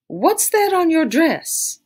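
high, slow, pointed question; suspicious. A narrator's reading. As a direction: narrow your eyes and demand it slowly. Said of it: "Belle was suspicious".

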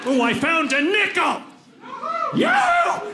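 shouted high, whooping glee; hooray. Acted. Old-timer finding a nickel, whooping hooray. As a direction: crow it out, soaring on the cheer. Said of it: "just another way to say hooray".

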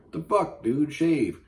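high, loud, disgusted shout; disgusted outburst. Real, not acted. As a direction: yell it at the screen in disgust.

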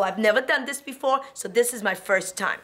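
ordinary talk

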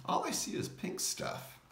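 high, whispered little mouse voice; puzzled mouse in a high whisper. Acted. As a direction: whisper it like a tiny peeking mouse. Mouse peers into the brain.

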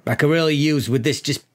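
lightly voiced New York boss, cajoling; mild precinct chief; police chief. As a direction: talk your detective into the job.

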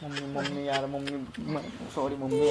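low, soft apology to mum; small, sheepish begging; subdued pleading. Acted. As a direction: plead quietly, voice dropped. Son pleads with angry mother.

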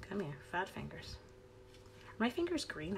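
ordinary talk, nothing marked

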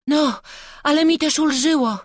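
put-on high boy's voice, loud with relief; relieved child, high-pitched; Jasiek relieved. A narrator's reading. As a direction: exclaim in relief as a lad. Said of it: "westchnął Jasiek".

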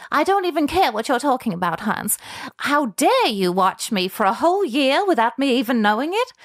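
loud, high, acted outrage; indignant outburst. Gladys tells off the scientist who watched her, loud indignation. Gladys answers Hans angrily.

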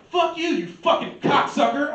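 high, loud mock yell of an insult; angry shout. Homeboy yelling in an argument, loud and high. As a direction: yell it at him in a rage. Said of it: "he was like".

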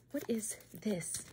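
slow breathy whisper. Acted. ASMR host quizzes the viewer in a slow whisper. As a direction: draw the question out in a whisper.

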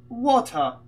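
"Water" as WAW-tuh in put-on British; lightly put-on British "Water"; mock British accent. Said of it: "Sono britannici di merda".

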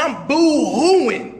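normal talking voice

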